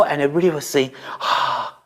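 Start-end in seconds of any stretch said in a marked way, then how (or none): none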